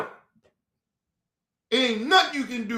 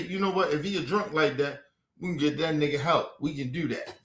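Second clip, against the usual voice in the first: mock wife voice; 6 semitones lower, 7 dB quieter than usual. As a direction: lower and softer, imitating his wife. Quoting his wife.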